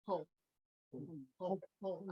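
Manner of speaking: Hong said as a short open "on" with a silent H; saying Hong. Shown as a demonstration. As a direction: sound out Hong the way it should be said. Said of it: "on le dit Hong".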